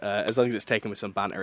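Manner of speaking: ordinary delivery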